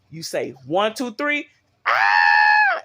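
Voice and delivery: counts calmly, then a loud far-off scream at the end; mock childbirth scream. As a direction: count to three, then let out a big scream. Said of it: "she just screaming".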